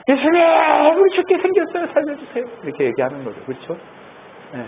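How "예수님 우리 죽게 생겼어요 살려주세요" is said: high, wailing plea to be saved; panicked cry for help. Acted. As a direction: cry out in terror, pitched way up. Disciples crying out to Jesus. (높은 목소리로 울부짖듯 살려달라고)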